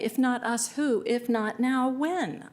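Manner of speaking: normal talking voice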